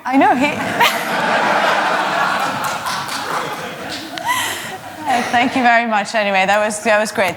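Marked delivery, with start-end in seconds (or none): 0.5-5.0 long airy laugh falling away; can't stop laughing at the joke; real helpless laughter. Real, not acted. Laughs after money joke.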